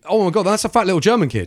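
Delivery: high, loud squeal; excited mock shriek. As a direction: shriek it gleefully. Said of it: "Where she's just like".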